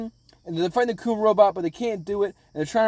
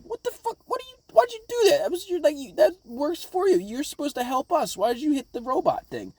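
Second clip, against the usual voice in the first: high-pitched indignant outburst, pitched way up; outraged crew; 9 semitones higher than usual. Retold or imitated. Crewmates yelling at Kuma in high outrage. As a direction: squeal it like betrayed friends.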